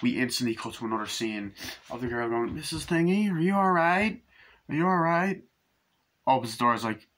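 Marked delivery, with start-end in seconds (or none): 2.5-5.4 higher, raised call with rising question; calling out to neighbour. Retold or imitated. A film character checks on a neighbour, calling out. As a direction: call out, voice pitched well up.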